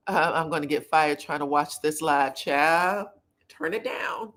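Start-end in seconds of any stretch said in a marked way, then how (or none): 3.5-4.3 whisper. Pretend. Warning viewers watching at work.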